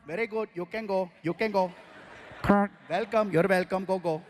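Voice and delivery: lightly voiced Indian-accented drivers; polite, bouncy Indian accent. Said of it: "they talk at the light with the horn".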